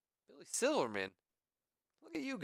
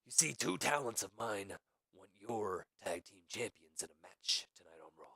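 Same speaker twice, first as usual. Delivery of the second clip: Vince McMahon; 3 dB quieter than usual. Retold or imitated. Acting out Vince's offer to Shane.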